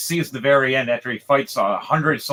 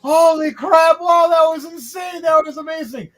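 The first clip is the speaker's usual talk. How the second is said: cheering crowd; 14 semitones higher, 8 dB louder than usual. Acted. Crowd members clapping for the winner, gushing loudly. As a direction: gush like a stunned fan in the stands.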